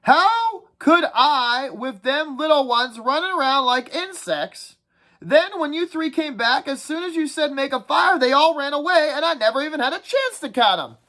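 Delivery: whiny schoolboy nagging the group, pitched well up; Piggy's voice. Acted. Piggy voiced in read-aloud.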